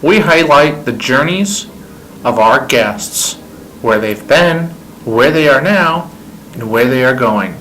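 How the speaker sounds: loud, stately radio announcer voice; announcer intro. Acted. Host introducing the podcast over music, booming announcer delivery. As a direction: roll each phrase out like a show opener.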